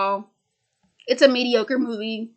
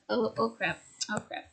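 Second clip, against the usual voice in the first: muttered dismay; 11 dB quieter than usual. Real, not acted. Sudden mishap while recording.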